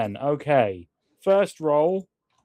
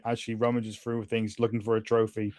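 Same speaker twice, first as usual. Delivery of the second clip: normal talking voice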